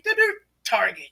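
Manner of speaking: light robotic Terminator voice, a scanning beep. Retold or imitated. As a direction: lightly mimic a robot locking on target. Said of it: "like Terminator scanning the vicinity".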